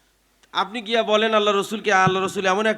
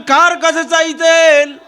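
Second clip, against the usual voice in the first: high, drawn-out rallying shout; loud call; 9 semitones higher, 8 dB louder, much slower than usual. Shown as a demonstration. Preacher asks the crowd to answer out loud, loud call. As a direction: throw the question out loudly and stretch it. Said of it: "আওয়াজ দিয়া বলেন".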